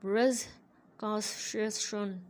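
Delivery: ब्रिटिश कॉन्स्टिट्यूशन mumbled into blurred syllables; slurred speech demo. Shown as a demonstration. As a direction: swallow the consonants of ब्रिटिश कॉन्स्टिट्यूशन, unclear and slurred. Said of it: "slurred speech है".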